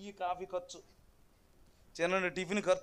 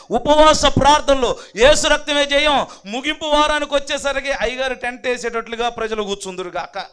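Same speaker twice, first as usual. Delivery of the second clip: raised sermon voice, pressing the point; insistent pulpit delivery; emphatic preaching; 4 semitones higher, 11 dB louder than usual. Real, not acted. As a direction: exhort the congregation firmly. Preaches declarations of faith.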